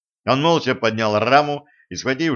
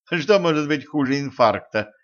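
theatrical exclamation; 4 semitones higher than usual. Acted. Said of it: "воскликнула Женька".

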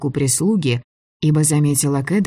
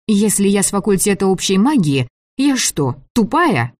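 higher-pitched indignant heroine; 6 semitones higher, 3 dB louder than usual. A narrator's reading. Girl challenging the director's slight, indignant. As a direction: take offence, pitch climbing on the question. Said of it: "я с негодованием фыркнула".

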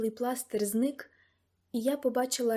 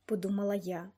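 ordinary delivery, nothing marked

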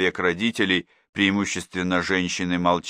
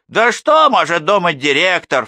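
higher, louder scoffing question; scornful snort; 10 semitones higher, 8 dB louder than usual. A narrator's reading. First mother scoffs at the principal, scornful. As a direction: scoff at the director. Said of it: "фыркнула первая".

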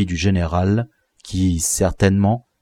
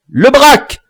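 high, loud call of the name; boy yelling the captured leader's name; echoing shout; 7 dB louder than usual. Acted. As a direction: shout it back like an echo. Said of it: "fit Tintin en écho".

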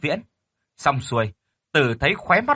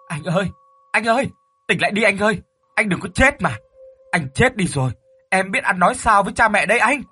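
high, desperate crying-out to a brother; wailing plea; 8 semitones higher, 3 dB louder than usual. A narrator's reading. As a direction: beg him to wake, as if wailing. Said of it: "những tiếng của thằng Tế như gào khóc". (gào khóc van nài)

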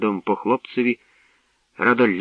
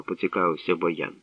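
ordinary delivery, nothing marked

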